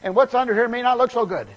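high, loud, comic protesting voice; silly Lazarus. Pretend. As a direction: ham up a dead man who loves his grave clothes. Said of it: "What if Lazarus would have said".

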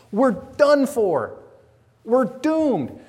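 high, loud, slow warning; preaching doom. Acted. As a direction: hit the doom hard from the pulpit.

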